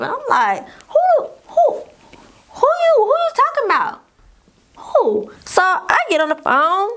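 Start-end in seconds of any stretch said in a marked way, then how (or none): 0.9-5.3 high squeaky who-who, acting stunned; shocked disbelief. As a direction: pretend to be baffled at the threat. Mock-surprised retort to threat.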